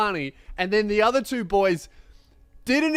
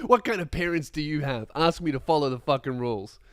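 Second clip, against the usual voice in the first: ordinary delivery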